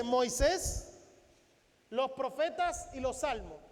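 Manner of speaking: normal talking voice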